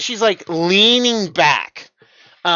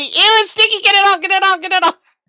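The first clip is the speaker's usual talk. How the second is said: high loud disgusted squeal; phobic panic; 13 semitones higher, 7 dB louder, faster than usual. Acted. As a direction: squeal it like she's covered in slime. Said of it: "flailing about wildly".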